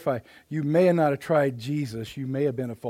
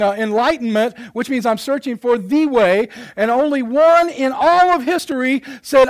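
loud, higher-pitched sermon delivery; forceful preaching; 8 semitones higher, 10 dB louder than usual. Real, not acted. Preacher telling congregation there is only one way, forceful preaching. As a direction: drive each point home emphatically.

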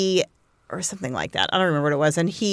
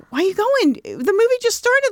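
high-pitched, incredulous questioning; baffled protest; 14 semitones higher than usual. Retold or imitated. Asking a friend why she's leaving the movie, baffled. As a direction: climb high in puzzled objection.

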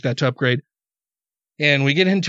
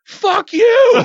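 high, loud outburst; re-enacted furious shout; angry yell; 7 dB louder than usual. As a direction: yell it at a stranger far off. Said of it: "I yelled at her".

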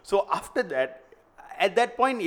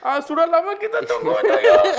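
very high, loud, cracking with laughter; stifled giggles; 8 dB louder than usual. Pretend. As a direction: squeal it, barely holding laughter. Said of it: "He's just trying not to laugh".

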